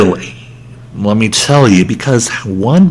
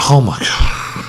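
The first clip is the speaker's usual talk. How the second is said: whispered exhaustion. Real, not acted. Exhausted after rant.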